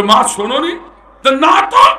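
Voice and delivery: put-on a son calling back to his old mother; a hint of the son's voice; voiced son.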